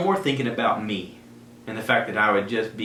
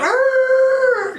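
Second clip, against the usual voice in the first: loud, much higher roar of thrill; roaring engine-rush yell; 7 dB louder than usual. Retold or imitated. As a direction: let out a wild sound effect. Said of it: "all the sound effects".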